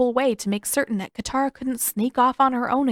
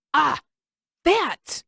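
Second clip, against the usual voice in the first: excited exclamation; 7 semitones higher than usual. A narrator's reading. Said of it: "Sokka pointed excitedly at Aang".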